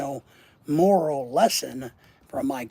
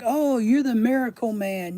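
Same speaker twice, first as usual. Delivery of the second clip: mocking gush; 6 semitones higher, 4 dB louder than usual. Mocking people in elevator.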